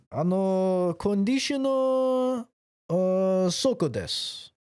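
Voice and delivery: high, drawn-out imitation of a Japanese shop worker; Japanese accent. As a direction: mimic dawning understanding in a stretched Japanese accent. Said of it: "they were just like".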